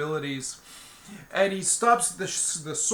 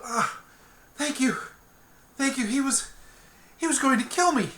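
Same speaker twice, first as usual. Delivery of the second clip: lightly voiced young woman, higher, grateful and shaken; a grateful rescued woman, pitched up; relieved captive girl; 9 semitones higher than usual. Rescued captive Anna thanks Eggle.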